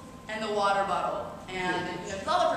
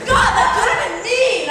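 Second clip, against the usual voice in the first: loud, much higher, laughing exclaim; laughing mock-jealous shout; envious excitement; 12 semitones higher, 15 dB louder than usual. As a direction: cry it out like you missed your big chance. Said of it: "and I was like".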